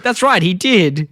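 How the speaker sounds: slow, lightly voiced naive mum; Marge. Naive mum agrees with son, lightly mocked, slow. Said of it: "Marge is so naive".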